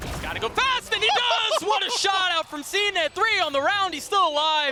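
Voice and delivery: hyped shouting play-by-play; esports caster. Real, not acted. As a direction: scream the clutch call high and loud. Clutch play, caster erupts.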